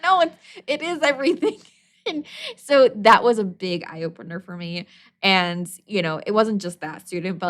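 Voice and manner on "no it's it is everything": emphatic disbelief. Retold or imitated. Teacher insisting.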